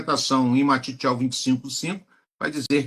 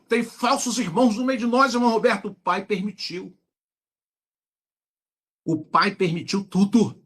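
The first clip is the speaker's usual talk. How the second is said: high, fervent preacher's refrain; preaching at full force; 9 semitones higher than usual. Acted. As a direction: preach it with rising fire. Preacher rebutting objections, raised voice.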